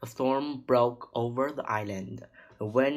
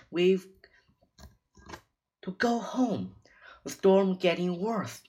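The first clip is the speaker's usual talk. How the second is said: high, raised plea; urgent; 7 semitones higher, 4 dB louder than usual. A narrator's reading. Girl urging her brother home before the storm, urgent. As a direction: press them urgently, voice pitched up.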